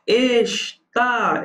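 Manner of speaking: Está split slowly into "Es" and a held "tá"; Está drawn out to test its stress; stress on the final syllable. Shown as a demonstration. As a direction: sound out Está in two high, stretched syllables. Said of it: "oxítona".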